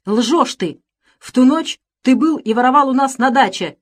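high, loud outburst; indignant, rising into a shout; angry accusation. A narrator's reading. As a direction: hurl the accusation at a thief. Master accuses the suspect.

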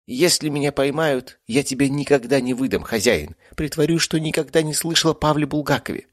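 a devoted tomcat's promise; cat werewolf voice. A narrator's reading. (высоко, преданно)

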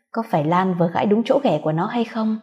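ordinary delivery, nothing marked

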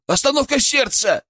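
very high-pitched emergency cry; alarmed yell. A narrator's reading. Colleague announces cardiac arrest, yelling in alarm. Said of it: "заорал Петров".

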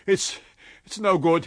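loud, high gasp of defeat; sobbing for breath. A narrator's reading. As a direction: break it up as if winded. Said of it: "as if sobbing for breath".

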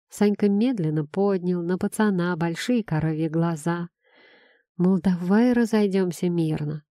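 usual speaking voice

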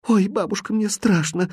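put-on high, small frightened child voice; acted childish fear; scared little girl. A narrator's reading. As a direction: whimper to granny, without raising volume. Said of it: "закричала Машенька".

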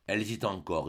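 ordinary delivery, nothing marked

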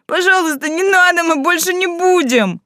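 high whining plea; whiny tattling little sibling. A narrator's reading. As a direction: whimper it like a child caught out. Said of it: "захныкали обе".

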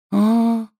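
put-on high, sulky ghost-girl voice; a gloomy schoolgirl ghost; sullen ghost girl. A narrator's reading. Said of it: "хмуро произнёс призрак".

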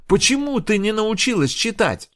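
high, loud furious yelling; furious shouting. Acted. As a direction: rage at the stupid pupil. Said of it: "закричала Инг". (в ярости, кричит)

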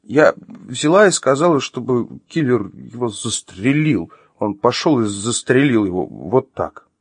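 slow, halting, high-pitched improvisation; flustered. Acted. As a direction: stumble slowly through a made-up answer. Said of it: "совсем растерялась она".

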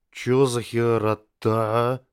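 warped voice of a mutating body; eldritch horror. Acted. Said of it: "заорал Терион ... странно искажённым голосом".

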